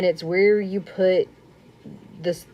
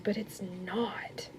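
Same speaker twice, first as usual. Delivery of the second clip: breathy whispered aside, much softer; 14 dB quieter, slower than usual. Real, not acted. To viewers, whispering that it's actually easy. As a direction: let the secret out in a whisper.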